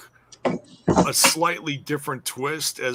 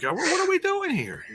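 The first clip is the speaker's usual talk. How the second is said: squeaky-high incredulous question; comically bewildered, high-pitched; mock bafflement. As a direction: act out disbelief, pitch shooting way up. Acting out own baffled reaction.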